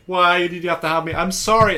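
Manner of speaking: high, loud imitated tearful wail; mock crying. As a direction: wail it like a film character in tears. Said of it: "they're both crying".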